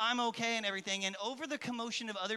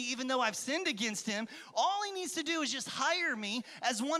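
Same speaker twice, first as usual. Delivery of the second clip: lightly voiced desperate son, a bit higher; pleading with himself to go home; prodigal son's plea; 6 semitones higher than usual. Son talking to himself on the road, mild despair. Son's inner monologue in parable.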